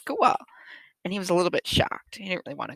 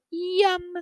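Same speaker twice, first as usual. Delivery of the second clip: happy yum; 10 semitones higher than usual. Acted. Cats enjoying their milk.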